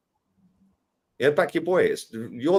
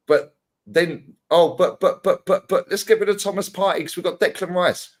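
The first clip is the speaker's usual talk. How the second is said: higher, louder sneering imitation of a flustered fan; mock stammering fan; 5 semitones higher, 3 dB louder than usual. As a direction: parrot the other side's stammering excuses with a sneer. Mocking rival fans' argument.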